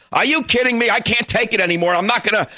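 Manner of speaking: high, exasperated disbelief; exasperated outburst. Real, not acted. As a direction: throw it out in outrage. Ranting host, building outrage.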